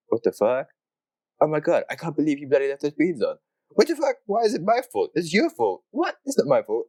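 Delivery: high, squabbling mock argument; mock bickering. Host replaying the kitchen fight with his friend, high mock squabble. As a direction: toss blame back and forth like two annoyed flatmates. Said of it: "me and my friend are like".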